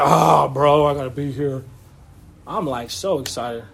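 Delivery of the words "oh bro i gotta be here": lower, dragging voice of someone who doesn't want to be there; mock an unwilling worker dragging his feet; reluctant grumble. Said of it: "I'm not like".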